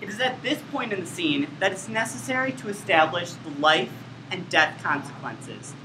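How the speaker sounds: ordinary talk, nothing marked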